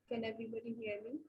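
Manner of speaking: very faint, distant voice; speaking far from the mic; barely audible. Real, not acted. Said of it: "Miss, your voice is very low".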